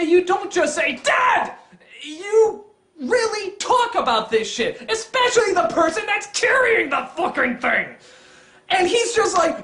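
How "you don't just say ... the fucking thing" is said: high, emphatic outburst; pitched-up, outraged insistence; indignant rant. Real, not acted. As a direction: hammer it home in exasperation. Indignant rant about consulting her.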